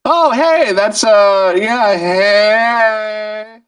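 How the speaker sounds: high, drawn-out hello; long high-pitched hey; delighted greeting. Real, not acted. Recognizes and greets a viewer.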